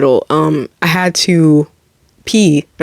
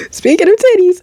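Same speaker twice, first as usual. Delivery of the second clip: much higher, laughing repeat; 11 semitones higher than usual. Real, not acted.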